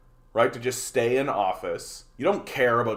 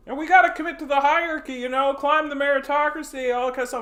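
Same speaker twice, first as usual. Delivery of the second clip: much higher, louder sneering singsong; mocking high voice; 5 dB louder, faster than usual. Mocking the meritocracy believers in a much higher, louder squeak. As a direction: squeak it out like a naive true believer.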